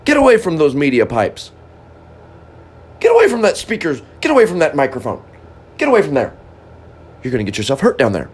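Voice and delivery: angry scolding. Acted. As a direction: scold him away from the outlet like a cross parent. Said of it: "Boy, you got angry at me".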